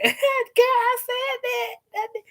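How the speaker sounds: high, slow, laughing confession; laughing admission. Retold or imitated. Jada giggling as she admits it, high and slow. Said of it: "She was kinda laughing like".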